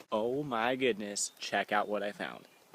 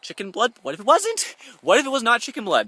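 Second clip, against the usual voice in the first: much higher and louder panicked; rising panic; 12 semitones higher, 10 dB louder than usual. Real, not acted. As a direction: spiral into real panic. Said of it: "I need to calm down".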